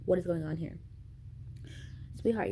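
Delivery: ordinary talk, nothing marked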